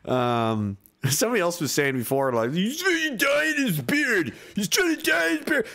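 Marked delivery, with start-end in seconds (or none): 2.5-5.7 high, drawn-out mocking whine; mocking commenter. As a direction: squeal it like a silly heckler, far above your voice. Mocking what someone said before.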